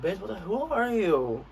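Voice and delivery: high, drawn-out incredulous retort; indignant challenge. Retold or imitated. Student to the stranger who pulled them off, indignant. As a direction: rise into an offended, stretched-out question.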